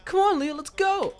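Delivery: very high, loud mock kid voice; excited kid imitation. Imitating his friend eager to ride the scooter, high excited squeal.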